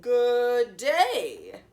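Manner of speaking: loud growly wolf hello; a touch of wolf growl; growled greeting. A narrator's reading. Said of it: "growled the wolf".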